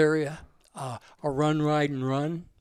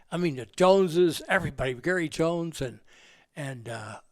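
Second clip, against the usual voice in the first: normal talking voice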